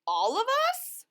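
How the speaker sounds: squeaky-high Loony Little, startled; little character voice. Acted. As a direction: pipe it up high like a tiny storybook bird. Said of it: "Loony Little replied".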